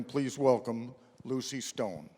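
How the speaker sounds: normal talking voice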